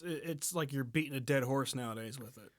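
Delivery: ordinary talk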